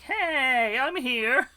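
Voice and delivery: loud, high cat greeting; cheery cat voice. Pretend. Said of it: "Looks just like".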